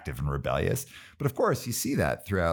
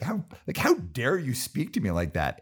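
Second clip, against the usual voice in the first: high, huffy mock outrage; prissy mock indignation, pitched up; mock indignant boss; 7 semitones higher than usual. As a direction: imitate a pompous boss taking offence. Mocking a rude boss.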